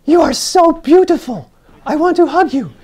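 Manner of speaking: put-on high and loud, adoring German old lady voice; German accent. Old German lady gushing over the costume, high loud German accent. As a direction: gush it in a thick German accent. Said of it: "she had a German accent".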